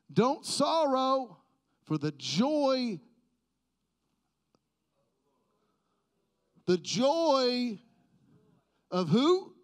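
loud, slow preached cry; preaching at full force. Acted. Pastor preaching to church, loud and slow. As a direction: shout it from the pulpit.

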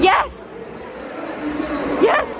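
high delighted yes; excited yes. Real, not acted. Vlogger recognising a character, high delighted yes. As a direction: pounce on the right answer.

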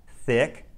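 "Thick" starting on a clear th with the tongue between the teeth; "Thick" with the first th held and blown out; exaggerated th practice. Shown as a demonstration. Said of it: "more minimal pairs to practice the T H sound".

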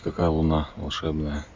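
ordinary talk

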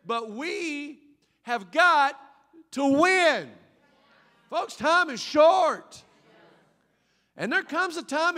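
loud, high rallying sermon voice; full-force preaching. Acted. Preacher urging the congregation, rousing and loud. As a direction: rouse the whole church to go all in.

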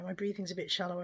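normal talking voice

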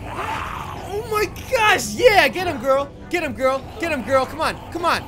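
loud, high cheer, urging her on; excited cheering. Real, not acted. Gamer cheering on the heroine mid-fight, excited cheering.

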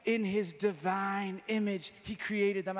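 high, loud, driving sermon voice; fervent preaching. Real, not acted. Teaching a crowd about the Trinity, fervent preaching. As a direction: preach it with full fire.

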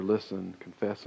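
usual speaking voice

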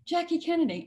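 high, breathy society lady voice; lightly voiced elegant caller; Jackie Kennedy. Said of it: "who said".

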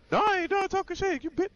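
mock shrill insult. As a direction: sneer it high like a cornered loser. Acted insult in retold argument.

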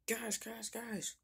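high, eager call for attention; excited calling out. Acted. Dave runs to the others with great news, excited call. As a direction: burst in bursting with news.

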